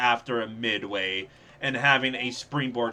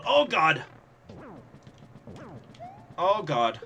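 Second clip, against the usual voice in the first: startled; 4 semitones higher than usual. Real, not acted. Sudden danger in game.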